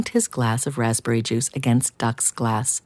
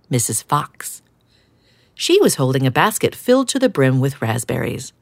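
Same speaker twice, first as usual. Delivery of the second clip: ordinary delivery, nothing marked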